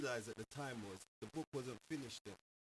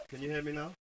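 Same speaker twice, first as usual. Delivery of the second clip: louder, to be heard; speaking up; 5 semitones higher, 12 dB louder than usual. Real, not acted. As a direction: project so the caller hears. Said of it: "wanted the gentleman to speak up".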